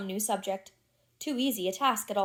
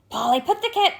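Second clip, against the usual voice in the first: high, loud parroting bird; raven's cry; 8 semitones higher, 6 dB louder than usual. A narrator's reading. Grip the raven waking his master, squawking high. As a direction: squawk it like a raven half-remembering. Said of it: "cried Grip".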